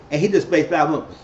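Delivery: ordinary talk, nothing marked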